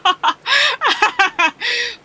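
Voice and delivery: loud high burst of laughter at herself; big laugh. Real, not acted. Streamer laughing at her own soup comparison, loud laugh. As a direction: crack up at how weird that sounded.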